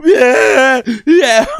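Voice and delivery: high, loud fake wail; hammed-up wailing cry; mock bawling. Man re-enacting bawling on his brother's bed, mock wailing. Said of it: "start bawling my eyes out".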